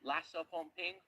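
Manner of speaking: ordinary talk, nothing marked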